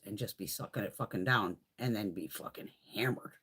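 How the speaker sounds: soft, unvoiced whisper; whispered punchline. Real, not acted. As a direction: drop to a conspiratorial whisper.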